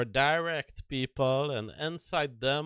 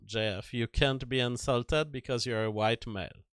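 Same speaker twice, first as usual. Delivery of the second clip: ordinary talk, nothing marked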